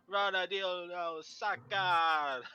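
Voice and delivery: half-failed imitation of a rolled-R game announcer; mock rolled R's. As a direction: drag out "Rana dildo Saka", trying to roll the R's. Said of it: "he rolls his R's".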